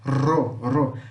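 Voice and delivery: Ro with a long tongue-tip trill; isolated trilled syllable Ro; vibrating rr. Shown as a demonstration. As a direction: roll the R hard in Ro, ro. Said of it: "Que vibra".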